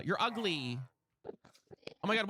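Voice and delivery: normal talking voice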